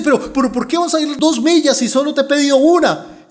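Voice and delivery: loud, high incredulous question; baffled protest. Acted. Soldier offered a second mile, baffled protest.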